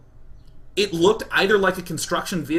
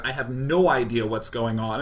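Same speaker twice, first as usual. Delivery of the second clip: ordinary talk, nothing marked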